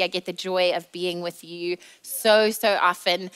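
normal talking voice

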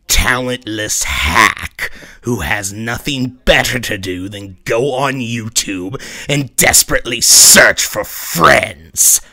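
loud tirade. Acted. Mirror self ranting at Mars, loud contempt. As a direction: hammer him with contempt.

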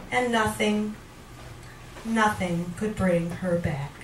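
deliberate, theatrical recitation with long pauses; dramatic poetry reading. Acted. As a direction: recite it as a dark monologue. Reads a poem aloud.

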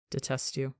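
soft sleepy mumble into hair; drowsy affectionate mumble; mumbled, no malice. A narrator's reading. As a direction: mumble it fondly, half asleep. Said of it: "mumbles into his hair with no malice".

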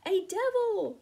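high, loud cry of fright; terrified cry. A narrator's reading. Deinonychus crying out at the glittering demon, high and loud. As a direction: shriek out in panic. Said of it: "cried the Deinonychus".

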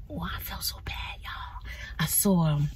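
hushed confiding whisper; soft, unvoiced secret-telling. Real, not acted. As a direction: lean in and whisper to your viewers.

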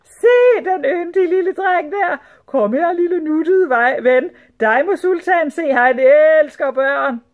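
high, loud, drawn-out gushing; squealing women. A narrator's reading. Sultan's wives calling the little boy over, squealing. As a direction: squeal and coo over a darling child. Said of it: "hvinede de".

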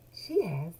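plain reading voice

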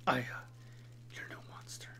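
hushed whisper, long pause after "Aya"; whispered reassurance. Shown as a demonstration. Partner gently reassuring Aya, in a whisper. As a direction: whisper "Aya", wait, then breathe "you're no monster". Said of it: "I wouldn't say it like that".

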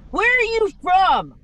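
put-on slow, high Southern-accented question. Friend's dad questioning a seven-year-old, in a Southern accent. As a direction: mimic a man's drawn-out Southern accent. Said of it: "he had a Southern accent".

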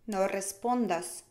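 ordinary talk, nothing marked

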